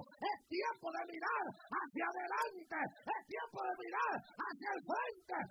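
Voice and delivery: loud rising sermon cry, slow and stretched; preacher building the crowd to a peak; preaching at full force. Acted. Preacher exhorting the congregation, loud and rising.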